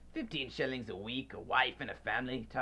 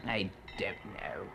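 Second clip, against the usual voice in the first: a softer, slower whisper of fear; frightened whisper; 4 semitones lower, 4 dB quieter than usual. Acted. Scrooge whispering in fear before Marley's ghost. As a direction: shrink away and breathe it out.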